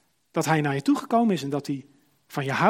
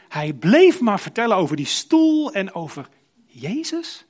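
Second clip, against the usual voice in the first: excited retelling; 5 semitones higher, 8 dB louder, slower than usual. Retold or imitated. Nurse describes the man's joy.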